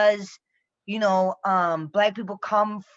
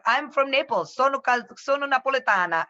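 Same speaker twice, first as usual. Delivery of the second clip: mock Italian; 4 semitones higher than usual. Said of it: "my funny thing that I would say".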